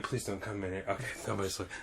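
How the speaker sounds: very soft, low mutter to himself; hushed plea. Retold or imitated. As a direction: mutter it under your breath, hoping nobody notices. Retold worried plea to himself.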